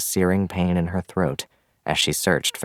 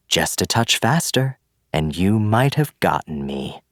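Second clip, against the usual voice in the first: louder sneering villain voice; gloating villain; 3 semitones higher, 3 dB louder than usual. Acted. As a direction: gloat over her. Villain gloats over fallen girl.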